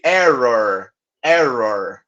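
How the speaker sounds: "error" with both Rs curled and growled; two growled Rs. Shown as a demonstration. Teacher drilling students on the two Rs in "error". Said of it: "Façam esse exercício aí".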